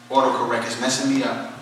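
ordinary talk

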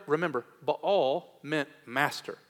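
ordinary talk, nothing marked

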